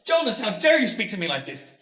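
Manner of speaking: loud, high rage cut by coughing; father yelling at his son, coughing; furious shout breaking into coughs. Acted. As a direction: roar at him until coughs choke it.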